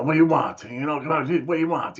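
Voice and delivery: high-pitched, pestered seller imitation; impatient shopkeeper. Guitar seller to a lingering kid, impatient imitation. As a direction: mimic an irritated vendor, pitched well up.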